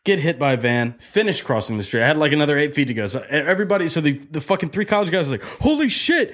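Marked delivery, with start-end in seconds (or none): 5.6-6.3 high-pitched startled exclamation; shocked onlookers. Retold or imitated. College kids seeing a man hit by a van, shocked. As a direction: exclaim it like a stunned bystander.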